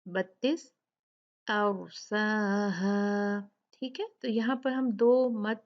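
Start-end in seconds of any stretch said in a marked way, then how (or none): 1.5-2.9 slow "अरसाहा" with long held vowels; "अरसाहा" chanted, long ending; two-count madd. Shown as a demonstration. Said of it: "दो count मद करके".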